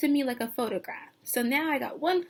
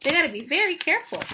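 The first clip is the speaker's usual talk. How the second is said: usual speaking voice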